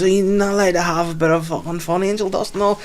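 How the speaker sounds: high-pitched imitation of a woman's complaint; high, whiny-drunk retelling; mock protesting woman. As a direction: imitate her indignant protest, pitched well up.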